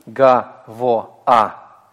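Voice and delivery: "Га, во, а" chopped into three separate syllables. Shown as a demonstration. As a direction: say "Га, во, а" syllable by syllable, pausing between each. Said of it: "не два отдельных слога".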